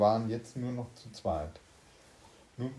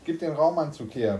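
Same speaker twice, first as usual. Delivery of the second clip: loud, higher-pitched order; bellowed demand; 6 semitones higher, 7 dB louder than usual. A narrator's reading. Thursday self fighting for the spacesuit, bellowing. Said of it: "brüllte der vom Donnerstag".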